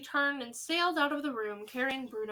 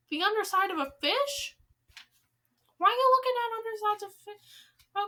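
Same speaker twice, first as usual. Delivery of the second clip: higher, baffled reader aside; amused surprise; 9 semitones higher, 5 dB louder than usual. Real, not acted. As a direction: break off laughing at the odd line. Reader puzzled by the text.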